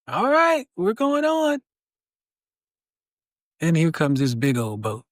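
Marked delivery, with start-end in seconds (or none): 0.1-1.6 much higher, shrugging voice; fine, suit yourself; rescuer giving up. Acted. Joke: rescuer gives up.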